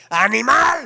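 loud shouted insult at another driver; retold insult shout. Angry driver yelling at another driver, loud shout. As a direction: bark the insult across the traffic. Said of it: "le grita al otro".

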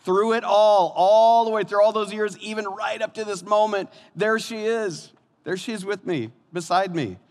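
high, heartfelt preaching crescendo, easing at the end; soaring testimony to a faithful partner; impassioned. Real, not acted. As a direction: proclaim it high and emphatic, then settle. Impassioned climax of sermon.

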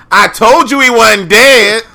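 high, loud gloating imitation; mock the friend's smug told-you-so; loud, slow I-told-you-so; mocking the Admiral. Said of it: "What happened when this episode comes up?".

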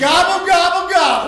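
loud, high gobble gobble shout; gobbling shout. Acted. Brother bursting through the door, shouting turkey gobbles.

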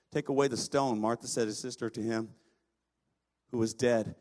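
low, measured reading of the Bible passage; scripture reading. Acted. Preacher reading the Lazarus passage to the congregation, measured and low. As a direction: read the Gospel aloud, evenly and low. Reads the Gospel passage aloud.

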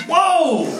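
high, loud amazed "Whoa!"; retold amazed whoa. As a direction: burst out in sudden amazement. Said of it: "And I went".

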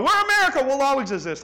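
high, loud mock boast; smug patriot. Mocking people who think America is eternal, hammed-up boast. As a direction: puff it up with naive pride. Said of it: "People go".

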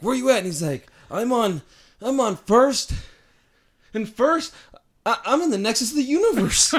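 high, loud Kramer-style yelling; hammed-up Kramer on the phone; excited manic neighbor voice; Kramer impression.

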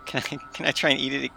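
ordinary talk, nothing marked

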